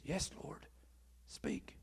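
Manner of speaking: very soft, falling to a whisper; hushed answer. Acted. Samuel answering the Lord at night, hushed. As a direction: answer softly, ending in a whisper.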